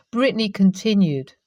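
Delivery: usual speaking voice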